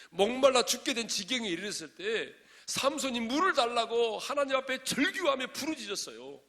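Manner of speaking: loud, high pulpit cry; full-force preaching. Real, not acted. Preacher telling how Samson cried out for water, full-force preaching.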